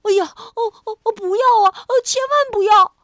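high, loud, fast frightened wails; panicked cry. A narrator's reading. Rabbit panicking about his ears, loud frightened cries. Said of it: "蹦蹦忽然惊慌起来".

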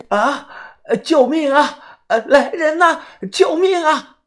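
very high, drawn-out acted screams; frightened cry for help. A narrator's reading. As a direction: cry for help as a terrified older woman. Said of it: "大妈被吓得是大声的呼救啊".

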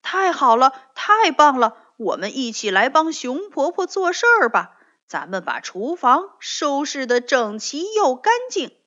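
loud, high, eager bunny cheer; happy and excited. Acted. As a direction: bubble over with joy, a little fast. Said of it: "跳跳也高兴地说". (高兴地大声说)

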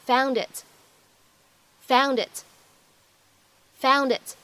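"found it" linked as foundit; linking. Shown as a demonstration. Teacher demonstrating linking to class. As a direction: join "found it" as one word. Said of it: "我们可以读成found it".

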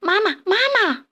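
put-on high, eager child-like call; little tadpoles calling out for Mama. A narrator's reading. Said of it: "赶紧追上去喊".

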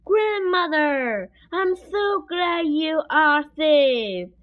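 relieved cry. A narrator's reading. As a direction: cry out slowly with glad relief.

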